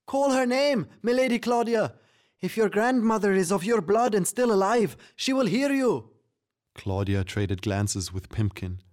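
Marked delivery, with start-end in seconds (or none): 0.0-6.1 very high, frantic creature voice; squeaky, excited shouting; urgent yelling. Acted. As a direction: urge it on at a high pitch. Pimpkin keeps yelling urgent advice.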